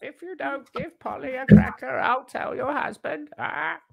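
high parrot squawk, slowing down; cheeky parrot threat, squawked high. Pretend.

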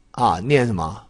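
ordinary talk

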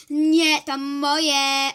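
loud, stubborn no to the teacher; defiant girl refusing the teacher, shouting; loud defiant refusal. Acted. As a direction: refuse her flatly and loudly.

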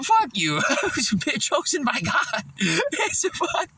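stoned giggly rambling; laughing while talking. Real, not acted. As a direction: giggle the words out.

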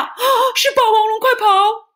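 high, fast, loud cry of alarm; little ones shrieking in fright; panicked shout. Acted. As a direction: scream a warning and run. Little dinosaurs flee in fright.

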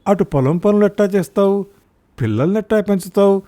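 a husband who sounds sorry for his wife; husband's pitying voice. A narrator's reading. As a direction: voice the husband lamenting her hard life. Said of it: "చెప్పాడు బ్రహ్మానందం".

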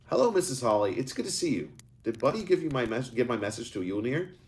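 put-on a young boy asking with a tremor; shaking voice. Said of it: "Eric asked with a shaking voice".

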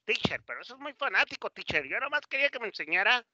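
alumno imitado, agudo y rápido; quéjate al maestro con voz más aguda; alumno quejándose. Acted. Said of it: "pensarás". (protesta aguda de estudiante)

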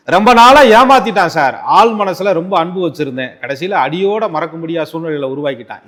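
mock outcry of a cheated lover; imitating jilted lover. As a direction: burst out like a betrayed lover, then settle. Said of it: "என்று சொல்லக்கூடிய துலா ராசிக்காரர்களுக்கு".

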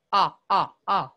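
"ah" short, punched and separate; short O sound. Shown as a demonstration. Teacher isolating the first sound of ostrich, sounded out. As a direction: repeat a quick open "ah". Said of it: "or an ah".

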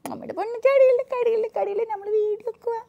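high sing-song coaxing. Real, not acted. Coaxing baby not to cry.

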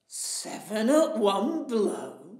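a big slow giant sounding out a boast. Acted. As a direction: giant puzzling over the tailor's belt. Giant reads the tailor's belt.

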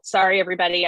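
ordinary talk, nothing marked

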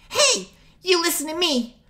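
very high, loud furious fairy; fairy snaps. Acted. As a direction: her patience finally breaks. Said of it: "Navi's patience snapped".

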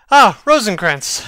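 loud, glad hail of a friend; delighted greeting. Acted. Hamlet greets his friends.

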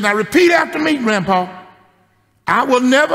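put-on high, loud child scolding; little girl, hand on hip, telling grandpa off; bossy little girl. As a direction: wag a finger like a sassy five-year-old. Little girl making him repeat.

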